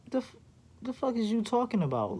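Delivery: high, disbelieving what are you on about; incredulous. Retold or imitated. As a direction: squeak it out in shocked disbelief. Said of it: "I'm like".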